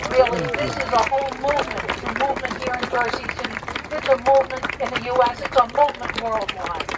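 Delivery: fast, rising rally cheer; rousing crowd speech, rapid fire. Acted. Rally build-up to closing cry.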